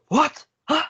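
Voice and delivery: sharp high angry "What? Huh?"; angry retold shout. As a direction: bark it back in disbelief like a furious keeper. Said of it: "he was so angry".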